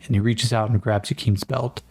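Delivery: ordinary delivery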